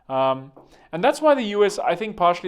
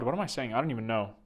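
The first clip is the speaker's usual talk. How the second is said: ordinary talk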